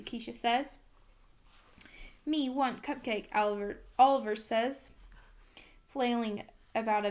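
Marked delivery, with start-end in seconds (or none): none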